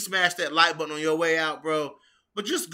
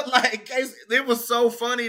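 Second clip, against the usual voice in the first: usual speaking voice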